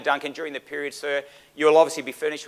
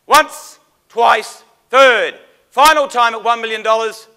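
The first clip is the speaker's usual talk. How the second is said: high, loud going-once call; loud auctioneer call; 9 semitones higher, 12 dB louder, slower than usual. Real, not acted. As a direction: belt out the final call. Auctioneer's final call before hammer.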